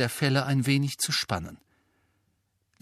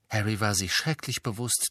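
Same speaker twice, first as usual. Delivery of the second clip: usual speaking voice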